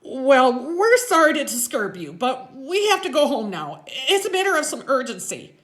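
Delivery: put-on high, loud kid being formal; loud, stiffly formal kid voice; kid playing grown-up. A narrator's reading. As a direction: strain to sound grown-up, still high-pitched. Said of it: "make his voice sound deep and more grown up".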